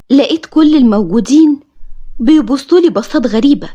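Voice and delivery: ordinary delivery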